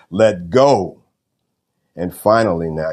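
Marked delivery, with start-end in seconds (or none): 0.1-1.0 louder, emphatic divine command; voice of God. Retold or imitated. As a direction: give the retold command firmly and louder. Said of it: "heard again the voice that said".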